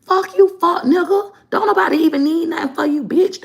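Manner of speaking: high-pitched, disrespectful cussing-out; brash sisters impression. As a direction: imitate mouthy sisters telling a man off. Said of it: "my sisters would be like".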